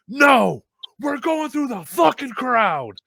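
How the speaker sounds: high, slow, emphatic shout; Kevin Graham shouting. Retold or imitated. Colleague grabs him, shouted refusal. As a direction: imitate someone roaring a refusal. Retold order after grabbing him.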